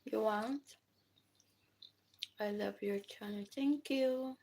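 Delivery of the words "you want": usual speaking voice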